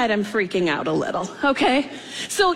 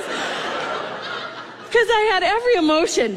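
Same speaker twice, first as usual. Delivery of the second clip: laughing; 7 semitones higher, slower than usual. Real, not acted. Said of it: "terror and hilarity".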